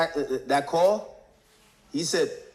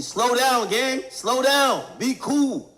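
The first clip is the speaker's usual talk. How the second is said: raised, higher voice, pressing a speeding driver; telling the driver to chill out, louder and higher; urging to slow down; 7 semitones higher, 3 dB louder, much slower than usual. Retold or imitated. To a friend driving too fast, a raised urgent plea. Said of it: "I told Boogie".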